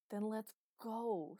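loud, drawn-out seething demand. Acted. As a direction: stretch it slowly with seething impatience. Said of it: "seethed Luke".

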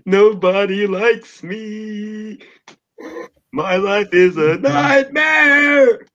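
high, slow, wailing sung lament; depressed Bart Simpson. Retold or imitated. Imitating the depressed Bart Simpson TikTok edits with a high, slow, sung wail. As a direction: wail it like a melodramatic sad cartoon kid. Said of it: "depressed Bart Simpson edits".

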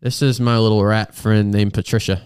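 ordinary delivery

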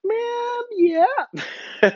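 drawn-out, very high squeal; comic high-pitched retort; mock exasperated reply. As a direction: stretch it out way up high, jokingly. His own mock reply, laughing after.

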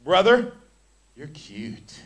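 a preacher mocking a flirty remark to a brother; mock flirt. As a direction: ham up a flirty whisper-like line. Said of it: "All sensual".

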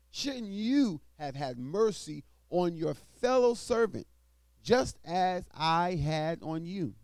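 scripture reading. Acted. Preacher reading Matthew aloud to the congregation, loud and proclaiming.

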